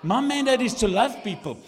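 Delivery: loud, high, building to a shout; full-force preaching. Real, not acted.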